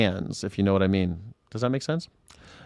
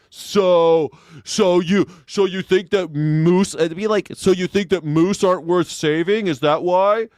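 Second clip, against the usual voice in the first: higher, louder smug debate bro voice; debate bro impression; 6 semitones higher, 5 dB louder, slower than usual. Mocking debate bro shows, smug and pushy. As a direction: play the smug debate bro cornering someone. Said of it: "you hear this on these debate bro shows".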